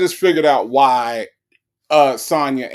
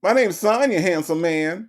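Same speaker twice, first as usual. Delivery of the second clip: flirty mock Sonya; 6 semitones higher than usual. Host imitating Sonya teasing the scammer, high and flirty. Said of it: "That's what that would be".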